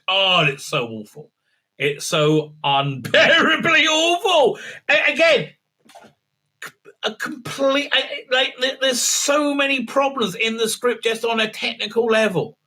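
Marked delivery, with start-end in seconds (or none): 1.8-8.8 slow, higher, laughing through the words; half-laugh, half-despair. Real, not acted.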